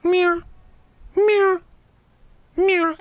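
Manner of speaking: high, level cat meows; cat meowing. Retold or imitated. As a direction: imitate a cat, meow after meow. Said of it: "because he's a cat".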